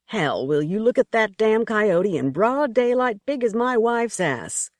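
a coarse hunter mocking the coyote; crude hunter. A narrator's reading. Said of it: "the man said".

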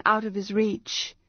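plain reading voice

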